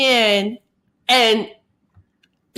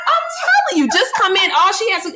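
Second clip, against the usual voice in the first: high excited; 7 semitones higher than usual. Real, not acted. Still laughing, excited recounting.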